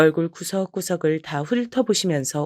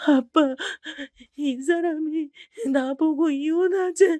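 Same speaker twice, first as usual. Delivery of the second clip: high, slow tearful; sobbing out the news; crying; 9 semitones higher, slower than usual. Acted. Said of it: "엉엉 울면서". (딸이 아빠에게 울며 이혼 얘기를 함)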